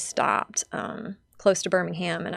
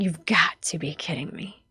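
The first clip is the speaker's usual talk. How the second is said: breathless, whispered disbelief; stunned whisper; 4 dB quieter than usual. Retold or imitated. She whispers to Roy in disbelief at his news.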